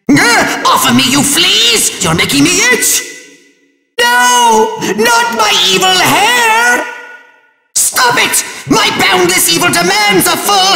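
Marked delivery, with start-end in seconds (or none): 4.0-6.9 high, melodramatic cry of dismay; anguished villain wail. Acted. Villain losing his evil hair, high anguished wail. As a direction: wail it as if robbed of something precious.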